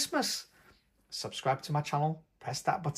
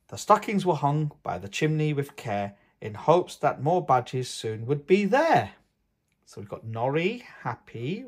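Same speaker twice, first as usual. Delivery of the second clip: lilting storybook read-aloud; reading to children; 4 dB louder than usual. A narrator's reading. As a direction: read the poem to little ones, gently sing-song. Reads Christmas poem to children.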